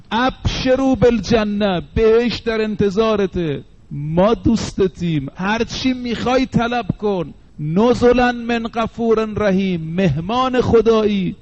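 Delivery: slow, lifted recital of the verse and its promises; slow preaching recital. Acted. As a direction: lay out each promise slowly, as if soothing the dying. Verse recited with tender gloss.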